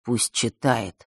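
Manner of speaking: low, soft, slow command; low hollow order. Acted. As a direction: order it quietly and dully. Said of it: "глухо велел он".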